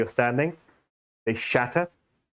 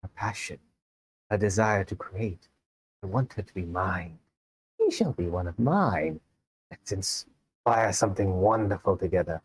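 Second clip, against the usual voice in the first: seductive voice; 3 semitones lower than usual. Acted. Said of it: "One says in, like, a seductive voice".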